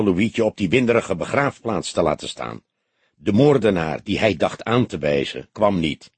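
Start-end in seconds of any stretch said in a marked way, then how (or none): none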